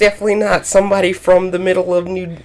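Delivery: high, exaggerated imitation of Chicago accent; mocking Chicago accent. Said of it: "It's my Chicago accent".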